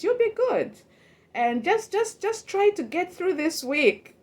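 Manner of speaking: high, coaxing reassurance; lightly mimicked encouraging colleague; reassuring coworker. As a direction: imitate a coworker cheering you on, pitched high. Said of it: "and they were saying".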